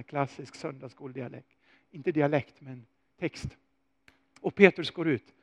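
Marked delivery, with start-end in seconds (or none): none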